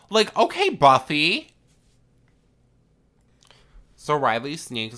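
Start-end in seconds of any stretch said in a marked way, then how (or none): 0.1-1.5 high, loud sassy reaction; loud incredulous tease; teasing mock awe. Real, not acted. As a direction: exclaim it way up high, ribbing the heroine. Teasing reaction to a character's bravado.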